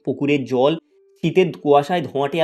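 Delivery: ordinary delivery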